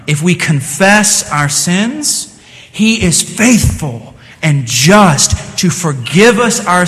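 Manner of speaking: slow, weighty Bible reading; scripture reading. Acted. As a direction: lean on each word of the verse. Reads scripture aloud.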